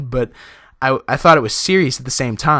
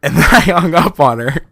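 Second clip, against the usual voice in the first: laughing through the words, louder; can't stop cracking up while telling it; real laughter breaking into the line; 3 semitones higher, 5 dB louder than usual. Real, not acted. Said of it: "the hardest laugh of my life".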